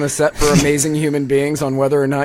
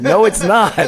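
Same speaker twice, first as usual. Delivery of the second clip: retold protest; 7 semitones higher, 4 dB louder than usual. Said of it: "I was like".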